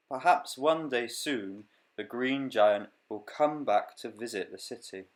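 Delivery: lively storybook reading voice; reading to children. Acted. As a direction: read it like a picture book. Reads picture book aloud.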